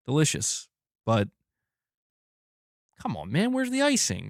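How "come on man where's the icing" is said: high, whiny-pitched mock demand; much higher, playful grumbling; mock complaint. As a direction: protest the missing icing in a high voice. Mock complaint about missing icing.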